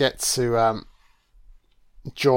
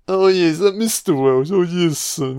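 higher-pitched put-on caller voice; mock phone caller; 5 semitones higher, 3 dB louder than usual. Mimicking the man phoning Mister Welsh, mock caller voice. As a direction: play a hammed-up caller asking for someone.

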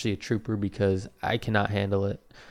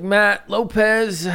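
high, drawn-out goofy name; mock announcing; 10 semitones higher, 4 dB louder, much slower than usual. Patient giving the receptionist his name, high and drawn-out. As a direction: stretch the name out high and silly.

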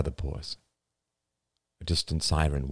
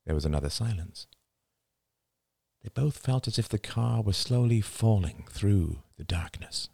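ordinary delivery

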